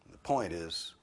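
ordinary talk, nothing marked

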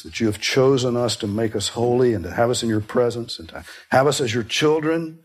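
gentle, earnest prayer voice. Acted. Pastor leading his church in closing prayer, hushed and earnest.

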